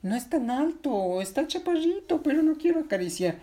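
put-on high, childlike character voice; high-pitched storybook protest; protesting Patinina. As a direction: argue back in a little high voice. Patinina argues back.

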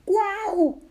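high, loud exclamation; squealed storybook wonder; amazed wow. Acted. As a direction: marvel in a high character voice. Berilio amazed.

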